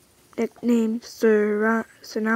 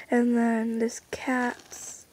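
slow hushed whisper; 6 dB quieter, much slower than usual. Real, not acted. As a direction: whisper it slowly.

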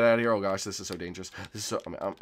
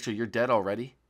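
ordinary talk, nothing marked

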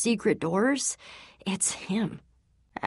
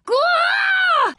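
sharp high pained "gah" shout; sudden loud higher gasp-shout; startled cry; 8 dB louder than usual. Acted. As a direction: jolt awake with a loud yelp. Waking confused and in pain.

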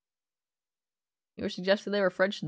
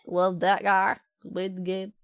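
ordinary talk

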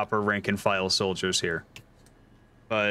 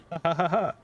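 evil mock laugh; 8 semitones higher, faster than usual.

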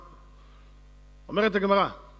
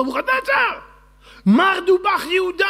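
very high, loud cry to the king; acted alarmed shouting; 12 semitones higher, 8 dB louder than usual. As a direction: shout the accusation out at the top of the voice.

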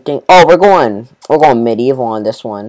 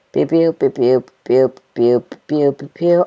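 mouth gun sounds. Pretend. Imitating gunfire.

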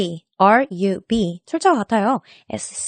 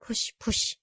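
breathy whispered chant; 9 dB quieter than usual. Shown as a demonstration. Teacher mimes the dance move, whispering. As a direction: whisper "push, push" with no voice. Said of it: "push, push 하면서".

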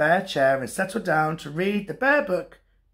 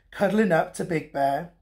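usual speaking voice

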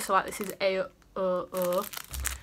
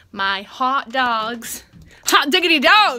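excited shout; 3 semitones higher, 13 dB louder, slower than usual. Real, not acted. Vlogger cheers about her hot dog dinner, building to a loud gleeful shout. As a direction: crow about dinner like a victory. Celebrating hot dogs for tea.

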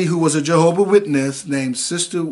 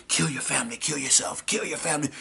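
soft, menacing whispered chant; demon voices whispering; 10 dB quieter than usual. Retold or imitated. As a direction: hiss the command over and over, hushed. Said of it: "the voice is coming from my arms".

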